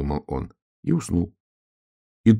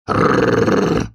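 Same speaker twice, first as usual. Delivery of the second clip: loud rolled-r snarl; long trilled animal growl; hoarse growl; 6 dB louder than usual. A narrator's reading. Donkey-wolf failing to speak, hoarse growl. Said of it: "хрипло зарычал".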